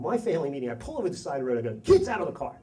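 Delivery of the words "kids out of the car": loud, higher order, imitating an angry dad; retold dad's yell. Dad orders kids out at roadside, retold yell. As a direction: call it out sharply as dad. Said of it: "yelling and screaming".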